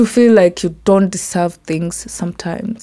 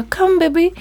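put-on much higher, sing-song baby talk to a toddler; mother cooing; 9 semitones higher, 3 dB quieter than usual. Modern mother calling her crawling baby, high cooing. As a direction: coax the baby to crawl over.

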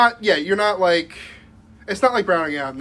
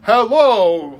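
loud, raised, overdone hello; mock loud greeting; 4 semitones higher, 8 dB louder than usual. Imagining answering the door on acid, mock loud greeting. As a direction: boom out a hammed-up greeting.

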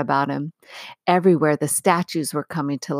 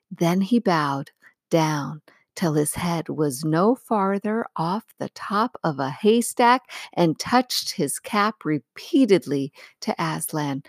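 ordinary delivery, nothing marked

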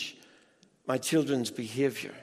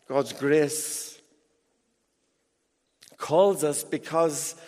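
usual speaking voice

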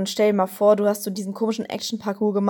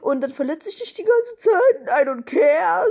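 put-on douchey boyfriend voice; 7 semitones higher than usual. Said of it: "der ist so".

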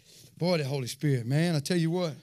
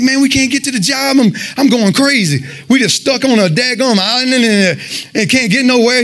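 higher-pitched, mildly exasperated retelling; retold frustration; 8 semitones higher than usual. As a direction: replay being mad at being stuck, voice raised in pitch. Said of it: "I'm getting mad. I'm angry".

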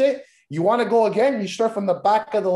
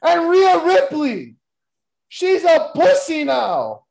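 mock trash talk; 8 semitones higher, 5 dB louder, slower than usual.

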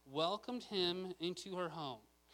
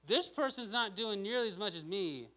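higher, louder mock complaint; frustrated; 4 semitones higher, 6 dB louder than usual. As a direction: protest indignantly about someone slacking. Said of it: "we can be frustrated".

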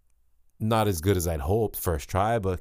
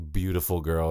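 usual speaking voice

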